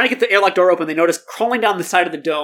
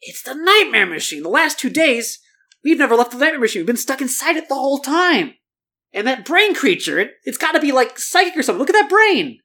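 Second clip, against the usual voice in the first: higher, urgent discovery; excited realization; 7 semitones higher than usual. A narrator's reading. Zach to his sister, higher excited realization. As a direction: build up the revelation.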